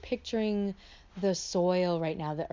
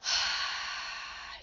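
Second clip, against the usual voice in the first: voiceless open-mouthed sigh; airy "hah" exhale, no voice; breath fogging a mirror; 3 dB quieter than usual. Shown as a demonstration. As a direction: breathe out as if misting glass. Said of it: "like you're fogging a mirror".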